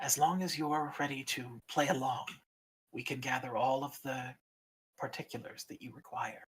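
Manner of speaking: hushed, measured ancient dragon; a stern guardian, softly grave; solemn dragon keeper. Acted. Egg Keeper continues.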